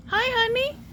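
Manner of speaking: high, rising coo; sweet cooing. Real, not acted. Greeting the bird that came right here, cooing. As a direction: greet a little animal tenderly.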